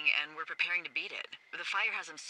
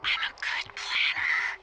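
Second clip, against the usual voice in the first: tipsy boast; 7 dB louder, slower than usual. Acted. Tipsy boast after drinking tequila.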